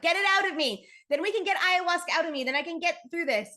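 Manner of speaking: frantic inner plea to purge it; desperate plea. Retold or imitated. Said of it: "I'm like".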